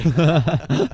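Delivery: high, loud burst of laughter; laughing hard. Real, not acted. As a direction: laugh out loud at the sudden death. Laughing at his own death.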